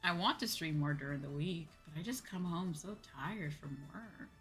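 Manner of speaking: normal talking voice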